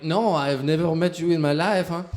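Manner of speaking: put-on French reporter denying it, French accent; loud mock French accent. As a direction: insist you've never met them, in a French accent. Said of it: "I'm like".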